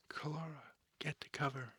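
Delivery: sharp loud call to take cover; shouted order. Acted. Commander yelling to Kalara to take cover under fire, loud. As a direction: bark the order over gunfire.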